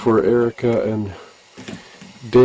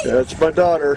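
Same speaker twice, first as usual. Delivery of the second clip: proud cheer; 6 semitones higher than usual. Real, not acted. Father cheering daughter in river.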